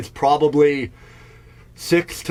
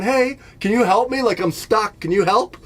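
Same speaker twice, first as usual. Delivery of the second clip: put-on kid voice; 7 semitones higher than usual. Kid stuck asks for help.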